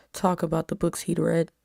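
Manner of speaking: ordinary delivery, nothing marked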